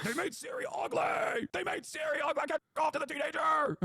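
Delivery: high, strained mock outrage; mock whiny gamer. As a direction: ham up a crybaby gamer's complaint. Mocking angry gamers' complaint.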